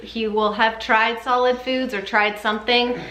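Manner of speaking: normal talking voice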